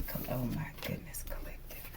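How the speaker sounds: hushed, breathless whisper. Real, not acted. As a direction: gasp it under your breath. Startled reaction, unvoiced whisper.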